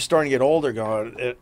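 ordinary talk

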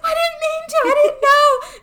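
put-on a little kid wailing an apology; child pleading. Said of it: "I was like".

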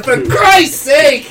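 slow, furious yell; angry shout. Acted. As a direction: blow up at him in exasperation. Acted quarrel over the trolley.

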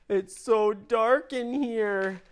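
little voice peering into the dark; high child voice. A narrator's reading. Child in the dark, high and slow. Kids inside the spider.

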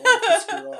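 high, loud, laughing outburst; laughing exasperation. Real, not acted. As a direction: burst out in amused disbelief. Exasperated joke about the odd accent.

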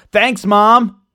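loud, high-pitched sarcastic thanks; mock-grateful. Host jabbing at mom for not sharing money know-how, mock-grateful. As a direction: call it out with pointed, exaggerated gratitude.

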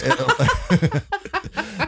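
high burst of laughter; high-pitched giggling fit. Real, not acted. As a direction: crack up at your own joke. Laughs at own asbestos joke.

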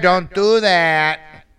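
very slow, drawn-out mock plea; hammed-up helpless pleading. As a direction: draw out every word in a silly begging tone. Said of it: "say it in that tone".

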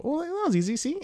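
very high, squeaky innocent voice; wholesome little boy. Acted. Comic wrapping up the joke list, high wholesome voice. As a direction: chirp it in falsetto. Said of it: "And then I go".

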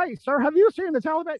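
high mocking voice of a clueless soldier. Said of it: "if you're the guy going".

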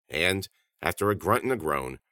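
plain reading voice

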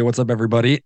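ordinary delivery

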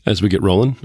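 ordinary delivery

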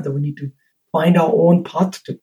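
ordinary talk

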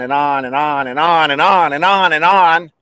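run-on singsong repetition; endless drone. Real, not acted. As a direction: roll the words on without a break. Exaggerating an endless list.

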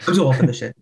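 quick run-together catchphrase; really fast. Retold or imitated. Host redoing the fast talker's cop line, hurried. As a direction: toss it off in a mock rush. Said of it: "just really fast".

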